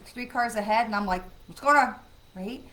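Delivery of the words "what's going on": sudden loud alarmed burst; a retold jolt of alarm at brake lights, loud; alarmed exclaim. Driver spotting brake lights ahead, alarmed loud exclaim.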